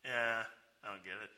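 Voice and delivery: low, quiet, baffled mumble; mock-confused, subdued reply; Nicodemus. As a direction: play a man who is totally lost. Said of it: "what was Nicodemus's response?".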